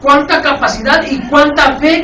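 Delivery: loud, driving sermon delivery; full-force preacher; fiery preaching. Real, not acted. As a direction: preach it hard to the congregation.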